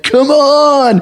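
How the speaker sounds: high, drawn-out rallying call; high-pitched call to follow. Retold or imitated. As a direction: wave the cavalry on from afar. Leading cops back, calling out.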